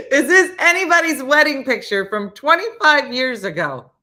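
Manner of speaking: loud, high laughing question; can barely get it out for laughing. Real, not acted. Laughing at the photo.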